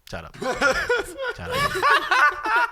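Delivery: giggling hushed then loud through laughter; telling cousin to hush, laughing; laughing shut up. Retold or imitated. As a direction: try to hush him while cracking up. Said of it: "I was like".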